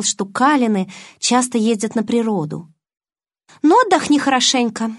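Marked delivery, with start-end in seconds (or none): 3.6-4.9 high, brisk-spirited good wishes; cheery. A narrator's reading. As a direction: wish them well with put-on cheer. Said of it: "бодро пожелала я". (бодро, приподнятым тоном)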